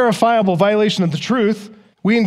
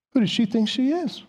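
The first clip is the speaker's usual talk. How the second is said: mock indignant grumble, softer and higher; offended muttering; 5 semitones higher, 5 dB quieter than usual. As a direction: grumble under your breath, put out. Imagined offended reaction at home.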